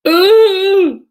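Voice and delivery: high, loud imitated wail; mock wail. Suspect caught by the police dog, wailing. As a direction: wail out like someone just bitten. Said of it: "and this wail".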